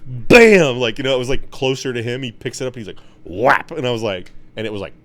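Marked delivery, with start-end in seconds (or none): 0.1-0.8 a big shouted bam; mock hit noise, punched out; loud bam effect. Retold strike on rat. 3.2-3.7 a shouted whap; mock hit noise; loud whap effect. Retold strike on rat.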